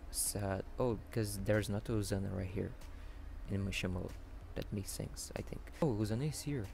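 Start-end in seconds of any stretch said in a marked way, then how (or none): none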